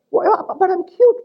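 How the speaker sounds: a hint of a small kid protesting; mock child. Lecturer voicing a child answering the parent, light mock child.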